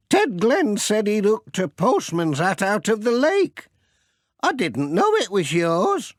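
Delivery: fussing, grateful grandmother; Granny Dryden's voice. Acted. Old lady thanking the postman for chasing her washing, high and fussing. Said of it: "said Granny Dryden".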